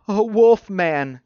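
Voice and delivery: sniffling. A narrator's reading. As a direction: draw it out high and slow, upset. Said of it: "Christopher sniffled".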